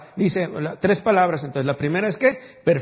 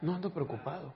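softer, gentle fatherly reassurance; tender voice of God; 5 dB quieter than usual. Acted. Pastor voicing God softly reassuring his son.

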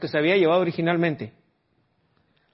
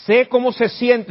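mock consoling; 5 semitones higher, 5 dB louder, slower than usual. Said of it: "Y entrando les dijo".

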